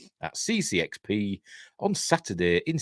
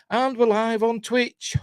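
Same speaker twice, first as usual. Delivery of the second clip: high, slow announcer call; excited announcement; 12 semitones higher, slower than usual. Real, not acted. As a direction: proclaim it grandly. Announcing going live, drawn out.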